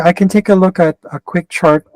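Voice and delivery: ordinary talk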